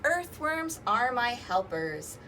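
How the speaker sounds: ordinary talk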